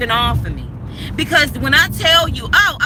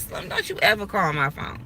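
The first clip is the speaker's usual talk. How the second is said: firm warning; 6 semitones lower, 8 dB quieter than usual. Retold or imitated. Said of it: "And I said".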